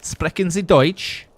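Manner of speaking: slow, high, loud mock German accent. Joking with a friend about his name, mock German accent. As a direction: ham up a movie German accent.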